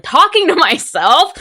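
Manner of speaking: crying shout. Shown as a demonstration. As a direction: wail it out like you're breaking down. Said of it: "I'm talking to myself".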